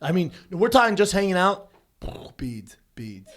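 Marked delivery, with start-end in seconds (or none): 2.4-3.3 whispered, soft and hushed; whispered "Beads, beads". Acted. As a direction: hush it like a secret. Whispered for effect.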